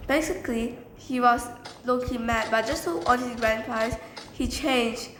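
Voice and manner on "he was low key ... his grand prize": plain reading voice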